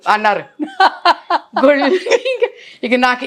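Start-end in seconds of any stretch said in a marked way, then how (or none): 0.5-1.6 bursts out in a high laugh; amused high laugh; real laugh. Real, not acted. Laughing at his joke.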